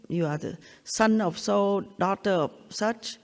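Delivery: ordinary talk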